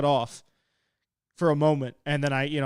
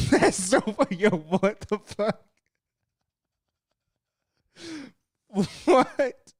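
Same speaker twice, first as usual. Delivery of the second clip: cracking up, voice pitched up in disbelief; real laughter at a ridiculous nickname; laughing hard; 6 semitones higher, much slower than usual. Real, not acted. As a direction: laugh through the words at a chat joke. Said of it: "Oh, I'm crying, bro".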